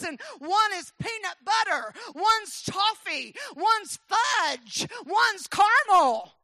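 high, loud triumphant proclamation; full-force preaching. Retold or imitated. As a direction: slowly build each item into a pulpit shout.

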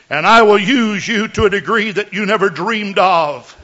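solemn prophecy in the voice of God; mock biblical prophet. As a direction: speak as the Lord, lofty and grave. Prophecy in God's voice.

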